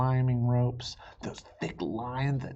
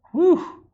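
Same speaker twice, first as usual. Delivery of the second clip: relieved whew; 11 dB louder than usual. Acted. Relief exclamation.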